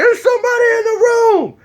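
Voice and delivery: high-pitched mock panic; high, alarmed retold cry; panicked warning. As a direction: alert your cousin in a squeal. Panicked warning retold in haunted house.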